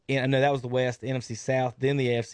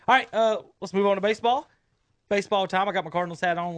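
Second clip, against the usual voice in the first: excited; 6 semitones higher, much faster than usual. Real, not acted. Excited shift to baseball segment.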